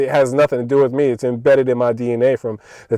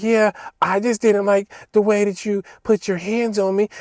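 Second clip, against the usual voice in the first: lightly mocking, higher-pitched chump voice; mocked chump; 8 semitones higher, 3 dB quieter than usual. Worker mimicking coworker who complains about being grabbed, mock chump voice. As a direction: imitate him as a meek chump. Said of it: "acting like a straight up chump".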